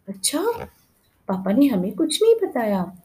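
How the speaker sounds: startled, worried question; a sudden jolt of worry at the news; alarmed. A narrator's reading. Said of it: "सुधा घबरा कर बोली".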